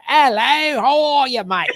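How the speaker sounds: high, loud, hammed-up Cockney; Cockney accent. Shown as a demonstration. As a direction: greet like a caricature Cockney. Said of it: "those Cockney folks with their shoulders".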